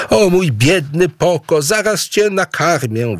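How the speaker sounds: calling out. Acted. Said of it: "wołała Aboe".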